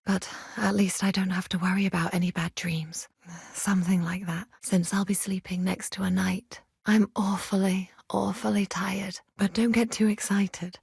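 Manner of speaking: soft unvoiced bedtime whisper. To the knight beside her at bedtime, whispered. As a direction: whisper it as if already lying down.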